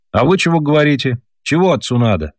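put-on a sleepy child scolding the grown-ups; boy's voice. A narrator's reading. Petrushka from the stove scolds his parents, lightly boyish.

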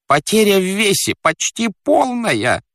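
high-pitched, plaintive character voice; wretched fat man. Acted. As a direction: confess it in a squeaky lament. Fat man confesses his predicament. (жалобный толстяк, высоким голосом)

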